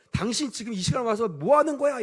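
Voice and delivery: wife's pretend outburst at the drunk husband, mock angry; imagined snap at husband. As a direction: snap at him the way she used to. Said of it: "이러면서 막 소리를 질러야 되는데".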